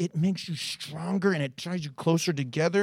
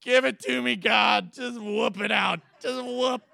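mock resigned plea; 3 semitones higher than usual. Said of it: "I'm like".

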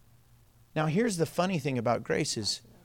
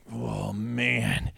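weary dismay; 8 semitones lower, 3 dB quieter than usual. Retold or imitated. Acted weary reaction to watching neighbor.

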